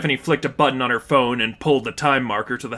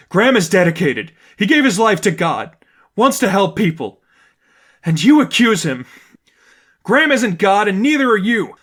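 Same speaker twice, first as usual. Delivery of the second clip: loud, higher, near tears; angry; 7 semitones higher, 6 dB louder than usual. A narrator's reading. Stephanie rebuking her friend, angry and tearful. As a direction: shake with fury.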